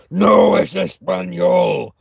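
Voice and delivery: put-on furious, slow shout of an offended old man; angry old man shouting. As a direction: bellow it back, outraged at the insult. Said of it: "he just flares up and kinda wants a fight".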